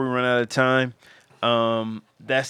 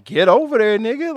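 much higher, louder mock daughter ordering him; imitating daughter's look; 11 semitones higher, 7 dB louder than usual. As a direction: voice the daughter's fierce silent command. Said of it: "she kinda moved her head like".

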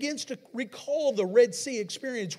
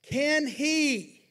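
higher, louder Can he; call; 6 semitones higher, 5 dB louder than usual. Shown as a demonstration. Preacher calling out for the congregation's response. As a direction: call it to the crowd, expecting answer. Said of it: "I will say this".

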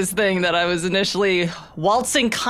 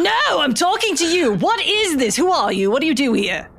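higher-pitched mock indignation at the start; mildly indignant; 8 semitones higher, 3 dB louder than usual. Visk questions the voice on the phone, mildly indignant. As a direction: demand answers, starting high and easing down.

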